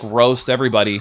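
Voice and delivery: ordinary talk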